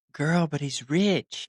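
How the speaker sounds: put-on high, slow, sassy girlfriend voice; mock girlfriend egging her on about a rich man; gossiping girlfriend. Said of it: "playing devil's advocate like a girl".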